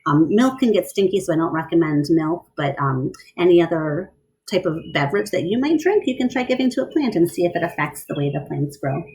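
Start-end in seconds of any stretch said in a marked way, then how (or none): none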